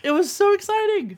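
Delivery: high, gushing excitement; excited. Real, not acted. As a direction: let the thrill burst out. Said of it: "I hear the passion in your voice".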